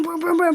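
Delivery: playful vocal gunfire; gunshot noises. Retold or imitated. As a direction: punch each boom like a shot. Imitating gunshots.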